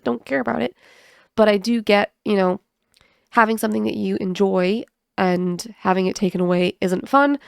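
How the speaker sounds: ordinary talk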